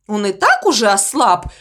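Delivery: plain reading voice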